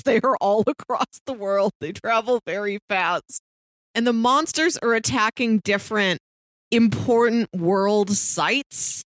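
ordinary delivery

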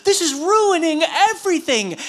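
high-pitched mock exasperation; hammed-up dismay; imagined indignant Pharisee. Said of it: "can you imagine what he was thinking".

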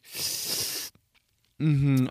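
slow, drawn-out mm-hmm; a gossiping prince, slow and smug; bitchy prince. Retold or imitated. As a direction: savor it like a gossip. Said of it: "I feel like a bitchy prince".

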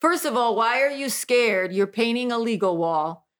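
normal talking voice

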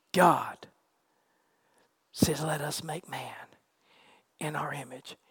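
low, soft sermon with long pauses; hushed pulpit delivery, phrase by phrase; hushed preaching. Real, not acted. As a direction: let each phrase settle reverently. Preacher drops to hushed reverence.

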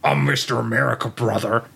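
lightly imitated wrestler boast; quick mock wrestler introduction; Hulk Hogan. Masked wrestler introduces his alter ego, mock boast. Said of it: "and he's like".

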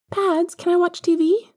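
put-on high, bright child asking a favour; young boy asking to watch TV; little boy voice. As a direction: pitch up into a small boy. Young Harry asks his godfather.

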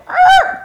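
short, loud, high-pitched screech; yelped engine-and-tire noise; imitated tire screech. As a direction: imitate a car squealing out in reverse. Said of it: "slam that car into reverse and go".

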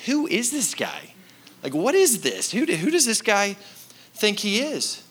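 a hint of a puzzled crowd member; skeptical onlooker. Crowd questioning the disciples, mildly incredulous.

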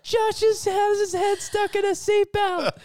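high, loud mock shout across the van; imitating a yell. Friend yelling to the whole van in a high shout. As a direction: call it out high and loud like a teen girl.

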